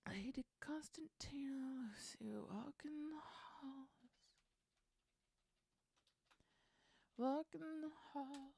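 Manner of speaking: breathy whisper of the line; whispered lyric try. Real, not acted. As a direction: whisper it to test the rhythm. Whispering draft lyric lines.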